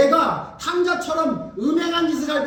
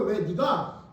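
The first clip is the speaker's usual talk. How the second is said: usual speaking voice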